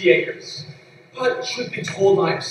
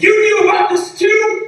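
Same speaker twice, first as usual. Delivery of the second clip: much higher, louder startled question; surprised; 12 semitones higher, 4 dB louder than usual. Acted. Businessman learning the secret is out, startled. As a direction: caught off guard, pitch jumps. His secret turns out known.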